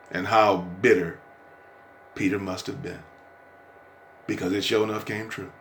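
normal talking voice

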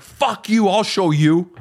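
a touch of youthful spite; defiant retort. Retold or imitated. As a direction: throw it back in their face. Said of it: "the more I'm like".

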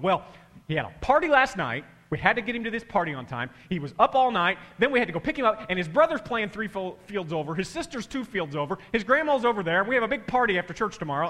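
loud, rushed harried mom; frazzled mom rant. Retold or imitated. Mom unloading on a stranger at the sideline, loud harried rush. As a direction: rattle it off, high and breathless. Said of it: "she starts going".

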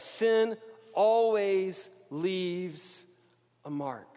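stretched out very slow, dropping at the end; slow refrain. Acted. Preacher as markers come out, very slow. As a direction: let it hang, then drop.

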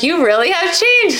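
high-pitched impressed friend; retold amazed praise. Friend praising her for staying calm, high amazed voice. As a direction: imitate a friend marveling, pitch lifted well up.

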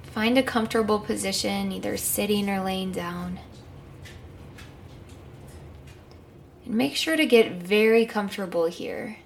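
calm guided meditation. Acted.

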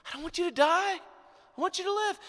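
high, pleading, tender; tender parent pleading; compassionate. Shown as a demonstration. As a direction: soften to plead with the child. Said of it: "you become compassionate".

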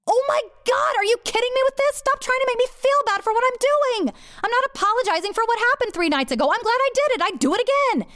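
very high, fast indignant rant; exasperated outburst. Retold or imitated. Woman refusing to feel bad before her lover, outraged. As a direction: let it fly high and defiant.

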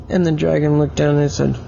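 ordinary delivery, nothing marked

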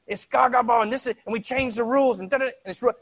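put-on high, loud kids squabbling over game rules; kids arguing. Preacher imitates kids arguing about gaga ball, high and loud. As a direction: rattle it off like a bickering child. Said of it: "I hear 'em out there".

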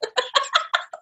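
high burst of real laughter; big laugh. Real, not acted. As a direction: let a sudden high laugh break out. Laughing at her own story.

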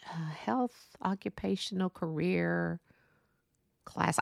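unvoiced whisper, slow, low and soft; whispered to herself. Real, not acted. Recalling a school class name, she whispers slowly and softly. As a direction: murmur the half-remembered name under her breath.

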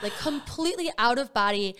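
ordinary talk

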